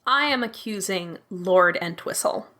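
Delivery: ordinary delivery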